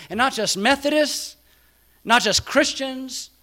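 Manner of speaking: high and loud, driving each not just; a loud, ringing pulpit appeal; full-force preaching. Acted. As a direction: preach it out to everyone. Sermon climax, inclusive call.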